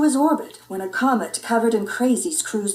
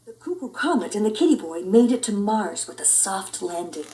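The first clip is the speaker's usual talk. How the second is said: plain reading voice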